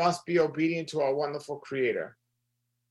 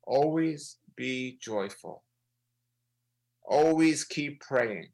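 slow, deliberate scripture reading; measured, drawn-out delivery for reflection; slower than usual. Shown as a demonstration. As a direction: read it slowly, giving each word room to sink in. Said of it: "I'm gonna read it slowly".

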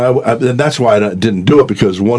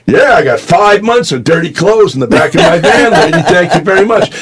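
eager loud reply; 8 semitones higher, 4 dB louder than usual. Retold or imitated. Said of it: "And I go".

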